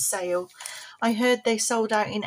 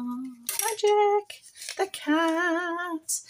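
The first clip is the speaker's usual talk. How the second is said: high squeaky puppet voice; 8 semitones higher, much slower than usual. Pretend. Envelope puppet Magic speaks.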